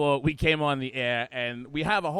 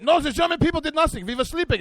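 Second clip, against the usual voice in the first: high, loud, hammed-up German accent; German tour guide; 11 semitones higher, 6 dB louder than usual. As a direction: deny it all in an indignant mock German accent. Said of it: "they're over in Germany".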